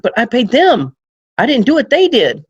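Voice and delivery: whiny protest, high. Retold or imitated. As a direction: client blaming the Indian company, in a high, pleading whine. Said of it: "he's like".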